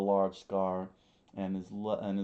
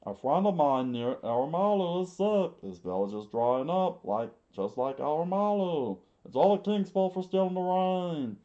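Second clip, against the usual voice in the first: put-on indignant kid, higher and louder; high defiant boy, restarted take; 10 semitones higher, 9 dB louder than usual. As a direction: insist like a stubborn boy begging to fight. Boy Kappa pleads to fight.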